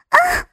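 high sudden yelp of alarm; sharp cry. Acted. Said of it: "林娜娇呼着". (高声娇呼)

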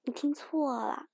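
ordinary talk, nothing marked

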